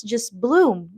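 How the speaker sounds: ordinary delivery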